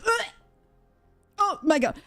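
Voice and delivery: high mock ugh of disgust; high mock disgust after tasting the skin; mock disgusted girl. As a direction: overplay a girl's disgust.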